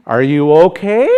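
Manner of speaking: "Are you okay" loud, with "okay" rising; exaggerated rising question on "okay"; high at the end. Shown as a demonstration. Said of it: "they go high at the end".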